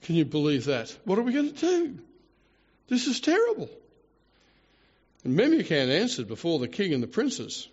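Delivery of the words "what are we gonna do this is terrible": high-pitched mock panic; mock blubbering. King despairs over the queen's refusal, hammed-up panic. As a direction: wail it like a helpless king. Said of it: "blubbering jackass".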